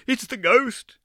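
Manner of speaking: put-on high, scared little girl's cry; sudden high shriek of a frightened dancer. A narrator's reading. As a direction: cry out the ghost in terror. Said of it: "little Jeanne had cried".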